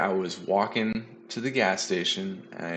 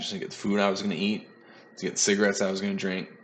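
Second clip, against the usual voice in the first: rattled off fast in passing; quick run-through; faster than usual. Real, not acted. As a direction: toss the line off quickly, half joking. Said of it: "Come on now".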